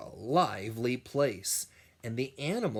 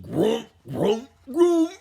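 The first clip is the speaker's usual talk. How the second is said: loud, rising grumbles; rhino grumble; 11 semitones higher, 11 dB louder than usual. A narrator's reading. Cranky rhino grumbling at the noise. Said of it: "the rhino grumbled".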